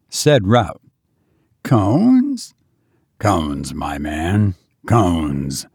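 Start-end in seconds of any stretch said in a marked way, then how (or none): 1.6-2.5 high rising disbelief on Cones; Cones? asked with a sharp upward lift; puzzled question. A narrator's reading. 3.2-5.6 low, soft, patient reply; a lower, knowing Horrocks; knowing answer. A narrator's reading.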